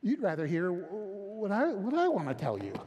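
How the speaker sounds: normal talking voice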